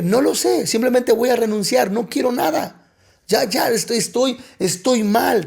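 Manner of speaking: high-pitched, agitated retelling of giving up; fed up and overwrought, pitched well up; distraught outburst. Retold or imitated. Acts out his agitated resignation to pastor.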